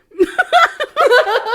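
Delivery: loud, high-pitched laughing fit. Real, not acted. Laughing at the NPC story.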